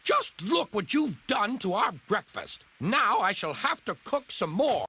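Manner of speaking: high scolding tone carried on. Acted. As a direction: keep nagging, pitched high. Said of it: "she scolded".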